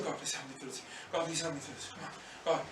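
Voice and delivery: very soft low begging; hushed pleading prayer. Retold or imitated. Jesus begging God for strength, hushed plea. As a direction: plead quietly through the pain. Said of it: "imagine maybe he was going".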